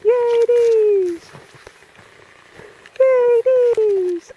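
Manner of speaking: high, loud celebratory cheer. Real, not acted. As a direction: cheer loudly for the dog. Celebrating dog's fetch.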